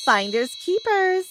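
high-pitched mocking taunt; gloating schoolgirl sneer. A narrator's reading. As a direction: sneer it in a lifted, singsong pitch. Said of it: "she sneered".

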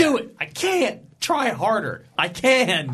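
high-pitched mock melodramatic back-and-forth; hammed-up hero dialogue. Co-host acts out a classic comic book moment, hammed-up and high.

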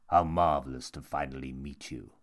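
low, suave intruder voice; sinister monk. A narrator's reading. Tall stranger finally meets his quarry, suave and low.

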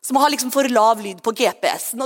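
normal talking voice

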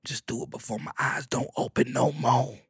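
a soft, quiet, lightly voiced mother; a hint of a frail old mama. Mama urging her son to make it, a light mama voice. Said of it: "She said".

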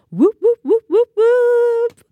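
high, loud whoops with the last drawn out; whoop-whoop hype cheer; celebratory whooping. Real, not acted. Celebrating first episode.

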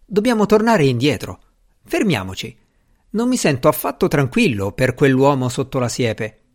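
plain reading voice

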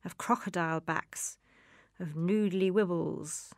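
ordinary talk, nothing marked

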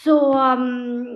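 usual speaking voice

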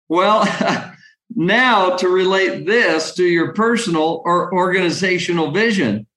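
ordinary talk, nothing marked

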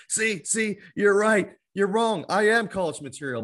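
gloating, vindicated I-told-you-so; triumphant retort. Pretend. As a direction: throw it back at the principal in triumph. Imagined retort to old principal.

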